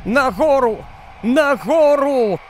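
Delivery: high, desperate shout upward; desperate cry. Acted. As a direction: cry out in panic to run upstairs. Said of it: "відчайдушно закричала". (відчайдушний високий крик)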